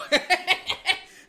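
high loud burst of laughter. Real, not acted. Laughs at interception.

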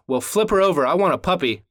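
put-on demanding kid. Son tells his dad what he wants, demanding. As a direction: lightly play a demanding little boy.

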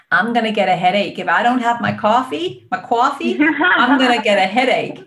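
hammed-up American accent, loud and rising; bad American accent. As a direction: exaggerate a protesting American woman, badly. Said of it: "sorry for a bad American accent".

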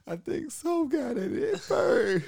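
put-on very high, slow child's whine; whiny little kid. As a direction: squeak it out like a scared little boy.

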